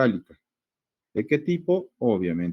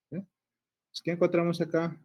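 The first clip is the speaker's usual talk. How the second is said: ordinary delivery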